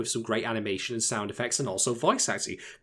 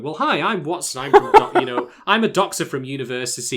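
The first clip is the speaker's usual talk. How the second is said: lightly mocked self-introduction, higher and louder; Watson; 5 semitones higher, 10 dB louder than usual. Host imitating Watson over-explaining himself, hammed-up and louder. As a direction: parody eager, needless exposition. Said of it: "Watson is not constantly going".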